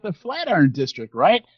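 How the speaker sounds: ordinary talk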